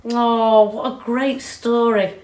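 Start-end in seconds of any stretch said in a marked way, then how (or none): none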